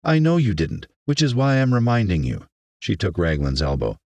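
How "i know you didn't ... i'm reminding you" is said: high, pointed reply; firm reminder. Acted. Mayor reminds the rebuked teacher, firm. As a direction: cut off the excuse firmly.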